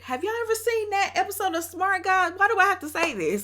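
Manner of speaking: high, loud, laughing; rushed giddy asking; excited. Real, not acted. Asking viewers about Smart Guys, high and laughing.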